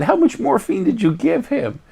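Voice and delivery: high, incredulous nurse voice; alarmed nurse. Retold or imitated. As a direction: play the nurse, shocked at the patient. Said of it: "looked at the ambulance driver and said".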